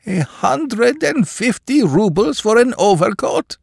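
high, slow shocked shriek. Acted. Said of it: "shrieked poor Akaky Akakiyevich".

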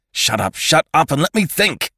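loud, climbing in pitch; exasperated, panicky shout; cried out. A narrator's reading. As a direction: yell for silence, frantic. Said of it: "he cried".

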